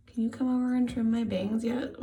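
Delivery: ordinary talk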